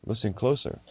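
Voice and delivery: ordinary talk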